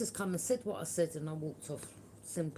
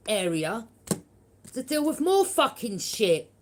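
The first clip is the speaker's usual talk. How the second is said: furious outburst; 8 semitones higher, 10 dB louder than usual. Real, not acted. Venting about bullies.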